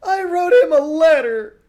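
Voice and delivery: very loud, high shout. Acted. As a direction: yell desperately from outside. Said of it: "the person shouted".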